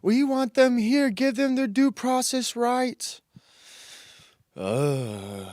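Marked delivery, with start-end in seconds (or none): 0.0-3.2 mock the protesters in a raised voice. Man mocking protesters over the shooting, high mock outrage. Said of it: "People are protesting about that".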